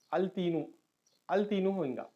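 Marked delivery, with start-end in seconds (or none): 0.1-0.6 put-on al-teenu for "التینو", built from al plus teenu; lam pronounced. Shown as a demonstration. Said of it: "ال-،". 1.3-1.7 put-on "التینو" read as al-teenu; lam pronounced. Shown as a demonstration. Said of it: "ال-،".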